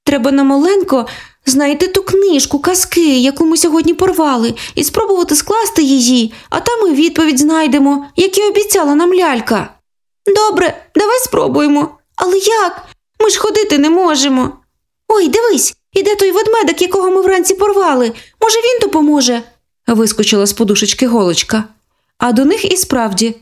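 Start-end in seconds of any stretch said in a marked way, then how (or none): 0.1-9.7 put-on high, earnest little girl; a sister with a plan; childlike and determined; girl's voice. Sister character speaks. 10.4-14.5 put-on high childlike voice, a touch of fear; a scared sister close to tears; little girl, lightly tearful; frightened little girl. Sister character speaks. 15.1-19.4 put-on high and quick, childlike; spotting the teddy bear with hope; bright girl voice, hurried; excited little girl. Needle girl spots bear.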